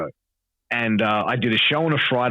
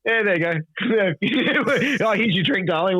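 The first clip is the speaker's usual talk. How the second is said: smug husband; 10 semitones higher than usual. Retold or imitated. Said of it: "I come back and go".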